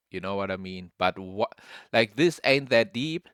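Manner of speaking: ordinary delivery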